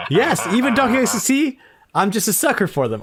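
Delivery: excited. Real, not acted. Excited concession about Donkey Kong.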